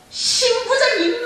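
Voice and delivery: very high, loud, drawn-out yell; furious outburst. Retold or imitated. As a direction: scream the curse at someone who isn't there. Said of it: "터져나오는 거죠".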